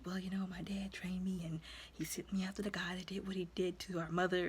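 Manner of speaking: soft whispered telling; hushed confession. Retold or imitated. Son telling his friend his past, in a whisper. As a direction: confide it quietly.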